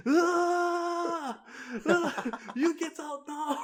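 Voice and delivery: high, drawn-out cries into a slow shout; mock pained yell. Kicked teacher ordering the student out, mock yelling. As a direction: imitate a kicked teacher hollering in outrage.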